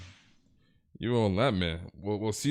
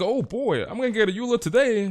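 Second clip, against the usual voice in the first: hopeful excitement; 10 semitones higher than usual. Retold or imitated. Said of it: "He's like".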